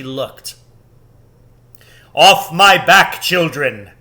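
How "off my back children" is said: loud, sudden command from the great lion; Aslan shouting. A narrator's reading. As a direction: bellow it as he charges into battle. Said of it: "shouted Aslan".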